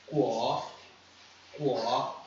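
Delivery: "guo" starting low and rising; second tone. Shown as a demonstration. Teacher showing the second tone, each "guo" said slowly upward. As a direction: lift the pitch through each "guo". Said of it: "segundo tom, subindo".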